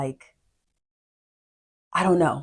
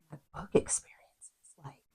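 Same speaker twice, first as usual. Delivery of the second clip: whisper; 14 dB quieter than usual. Real, not acted. Trailing into a whisper.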